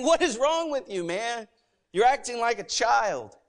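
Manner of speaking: high-pitched, exasperated mock telling-off; scolding God. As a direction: scold a childish man as God. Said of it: "And God's saying".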